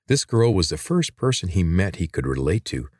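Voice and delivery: ordinary delivery, nothing marked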